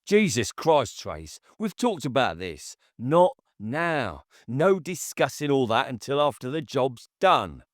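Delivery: put-on exasperated boyfriend dismissing her; hard, dismissive. A narrator's reading. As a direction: shut her down coldly. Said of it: "His voice was hard, dismissive".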